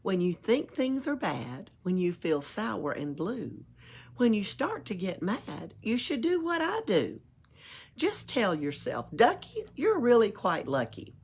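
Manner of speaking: plain reading voice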